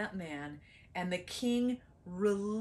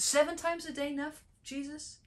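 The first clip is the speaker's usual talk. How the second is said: Peter asking; 8 semitones higher than usual. Acted. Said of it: "Peter was thinking".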